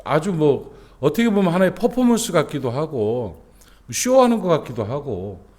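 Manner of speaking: ordinary delivery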